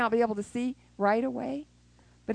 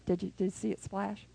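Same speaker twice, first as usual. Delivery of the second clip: ordinary talk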